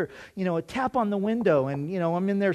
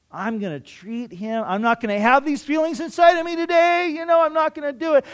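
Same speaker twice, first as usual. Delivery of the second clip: pep-talk resolve; 8 semitones higher, 5 dB louder than usual. Retold or imitated. Man psyching himself up to be nice to his rival, then loud and determined. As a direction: psych yourself up, louder and louder. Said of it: "I said".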